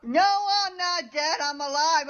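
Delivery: normal talking voice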